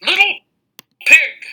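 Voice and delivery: very high, loud call; wolf calling out. Acted. Wolf calling the pig for the apples, very high and loud. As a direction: sing it out to the pig from afar. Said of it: "called the wolf".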